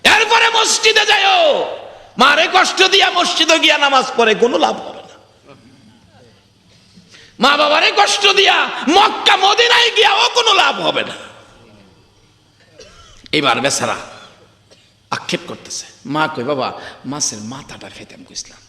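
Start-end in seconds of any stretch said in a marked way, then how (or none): 0.0-11.3 fiery waz exhortation; full-force preaching. Acted. 16.4-18.6 put-on frail old mother. Frail mother's faint reply.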